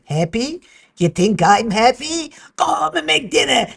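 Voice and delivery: put-on loud, high old bubby with a Yiddish lilt; Yiddish accent. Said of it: "my bubby said".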